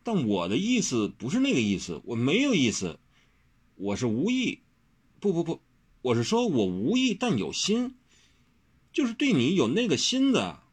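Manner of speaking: tongue-tangled, rising protest; flustered explaining. A narrator's reading. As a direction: scramble to explain yourself. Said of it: "他也不懂他现在在说什么".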